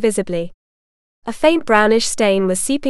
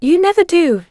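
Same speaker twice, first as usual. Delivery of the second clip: sharp, higher-pitched retort; snapped; 7 semitones higher, 4 dB louder than usual. A narrator's reading. Sister dismissing her brother's excuse, snapped. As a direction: snap back at a weak excuse. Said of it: "Jessa snapped".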